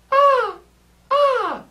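high, thin ah; weak head voice ah. Shown as a demonstration. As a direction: sing a high ah without brightness. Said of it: "we can't go".

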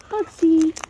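high sing-song call; calling the bird. Real, not acted. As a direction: lift the name up high to call.

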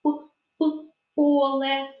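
"П, п" as tiny separate puffs, then "поле"; isolated first sound. Shown as a demonstration. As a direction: pop the p alone before "поле". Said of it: "який перший звук".

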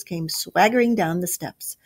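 ordinary delivery, nothing marked

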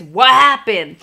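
loud called-out question; retold shout. Husband turning round to his fallen wife, shouting. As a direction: call back loud from up ahead. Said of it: "all I hear is".

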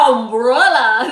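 loud, exaggerated "Umbrella"; "Umbrella" belted out with heavy Spanish-accented vowels; drilled English word. Woman recalling her English lessons, "Umbrella" loudly over-pronounced. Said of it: "Así como cuando estaba aprendiendo yo inglés".

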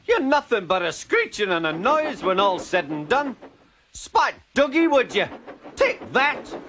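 high, indignant tirade; angry outburst. A narrator's reading. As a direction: lash out in a very high voice. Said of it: "Donald burst out".

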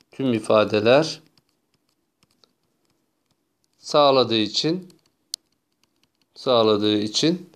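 slow, phrase by phrase, with long pauses; spaced-out words at writing pace; slow dictation. Real, not acted. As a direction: dictate it slowly as you write. Dictating slowly while writing it out.

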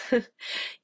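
brief breathy laugh; small chuckle at own aside; light chuckle. Real, not acted. Chuckles after remark about housework.

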